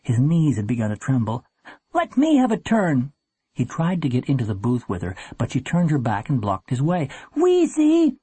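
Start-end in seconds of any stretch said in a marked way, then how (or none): 1.9-3.1 high, urgent plea; boy's high, anxious cry; panicked demand. A narrator's reading. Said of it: "Warren was gripped by panic". 7.4-8.2 very high, desperate call; high-pitched boy's yelp; panicked cry. A narrator's reading. Panicking boy calls his sister again.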